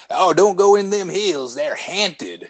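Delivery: high, creepy hillbilly warning; Beverly Hillbillies Southern accent. Acted. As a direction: put on a spooky backwoods Southern twang. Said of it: "Beverly Hillbilly one".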